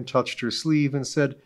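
ordinary delivery, nothing marked

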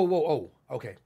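normal talking voice

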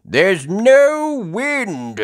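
very slow, loud, high drawl; Grandpa Pig. Acted. Grandpa Pig explains why boats are still, slow loud voice. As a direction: draw out each word loudly. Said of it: "says Grandpa Pig".

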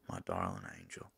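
soft, low declaration of love; tender farewell. Acted. Demon parting from angel lover, tender. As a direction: let the endearment sink low and quiet.

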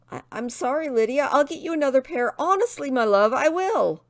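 high, hurried, stammering plea; nervous apology. Acted. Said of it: "Tristan peered nervously".

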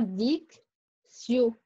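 dic, pause, sio with an s sound; word split into syllables. Shown as a demonstration. Teacher breaking down dictionnaire for pupils, separate dic and sio. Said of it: "Regardez là".

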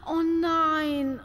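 theatrical dismay; dismayed gasp. Acted. Countess hears of the beating, dismayed.

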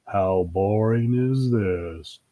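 slow, monotone character drawl; listless slow complaint; bored. Acted. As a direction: drag every word out, too bored to move.

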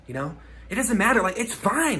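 ordinary delivery

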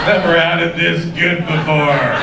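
deadpan, even-pitched fake rapture; mock sarcastic praise. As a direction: imitate a wife faking delight. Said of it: "She has sarcasms now".

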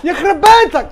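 loud, high amazed yell; excited shout. Real, not acted. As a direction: yell at the great save. Keeper Mendy saves penalty.

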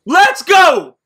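loud celebratory shout; frat bro. Real, not acted. Celebrating the pull.

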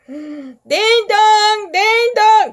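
high, loud repeated chime; doorbell imitation. A narrator's reading. Imitates insistent doorbell ringing.

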